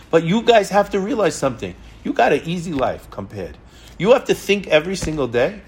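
normal talking voice

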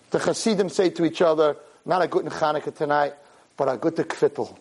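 normal talking voice

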